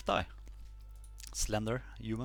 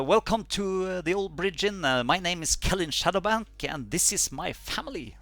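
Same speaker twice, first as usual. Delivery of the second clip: higher-pitched, welcoming innkeeper; lilting halfling host introducing his inn; 5 semitones higher than usual. Acted. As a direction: greet guests in a higher halfling voice.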